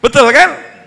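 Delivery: normal talking voice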